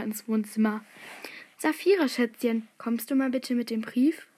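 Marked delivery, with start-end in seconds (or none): none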